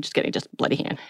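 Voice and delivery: quick whispered correction under her breath; whispered aside. Real, not acted. Narrator taking back her own joke, whispered.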